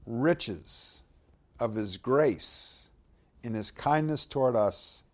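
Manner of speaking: reading Ephesians to the church, deliberate; scripture reading. Acted. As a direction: draw the verse out phrase by phrase. Reads verse seven.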